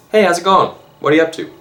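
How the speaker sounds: breezy hero saying hi to a starstruck fan; cheery Spider-Man voice. Retold or imitated.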